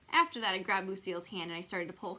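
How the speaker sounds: plain reading voice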